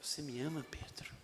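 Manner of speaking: very soft, low question; tender Jesus. Acted. Jesus asking Peter again, tender and hushed. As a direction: ask gently, almost a whisper. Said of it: "aquela voz".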